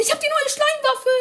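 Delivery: high, loud and thrilled; excited shout. Real, not acted. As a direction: blurt it out in delight. Thrilled at new game weapon.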